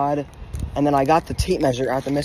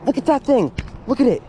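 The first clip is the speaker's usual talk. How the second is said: real excitement; 7 semitones higher, faster than usual. Real, not acted. Angler as the trout bites, high amazed exclaiming. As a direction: point and marvel, voice up high.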